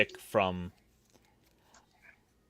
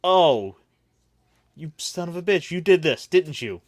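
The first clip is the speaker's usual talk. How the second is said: higher, louder mock accusation; betrayed dying man; 7 semitones higher, 7 dB louder than usual. Dying man to the friend stealing his wife, mock accusation. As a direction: play indignant realization, hammed-up. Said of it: "those are his last words".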